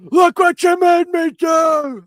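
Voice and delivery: high, slow, loud screeching shriek; screaming horror woman. Acted. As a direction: shriek it like a horror-film ghost. Voicing the scary film woman.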